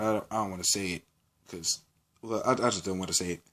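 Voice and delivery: soft, quick embarrassed mumble; quiet aside. Real, not acted. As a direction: drop the voice, rush it out. Embarrassed aside about cussing.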